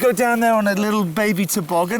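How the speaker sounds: high, loud exasperation; exasperated. Real, not acted. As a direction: scold him for being reckless. Mock scolding reckless friend.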